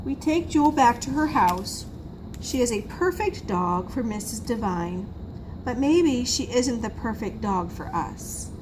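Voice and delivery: plain reading voice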